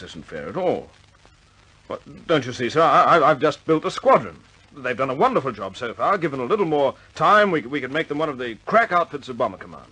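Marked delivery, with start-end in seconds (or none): 1.9-9.9 higher and louder, pleading hard; impassioned plea. Acted. Pilot pleading with commander for his squadron, impassioned. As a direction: beg to keep his squadron, pushing.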